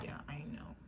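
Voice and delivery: breathy whisper, barely there; hushed knowing whisper; whispered aside. Retold or imitated. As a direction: whisper it like a secret you already knew. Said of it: "I'll be like".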